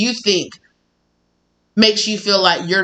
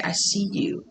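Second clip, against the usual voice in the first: hushed, very quiet tender words; gentle hushed reassurance; soft repeated whisper; 2 semitones higher, 8 dB quieter than usual. Retold or imitated. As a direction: murmur it softly, over and over. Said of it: "I just keep hearing somebody say".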